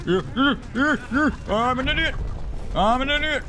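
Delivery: high squeaky self-mockery; mock himself in a silly high voice; self-mocking squeak. Player mocking his own blunder in a squeaky high voice.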